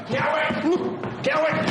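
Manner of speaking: high-pitched shout of a name; urgent call. Acted. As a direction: call out sharply, voice way up. Calling out urgently to a colleague. (appel crié, urgent)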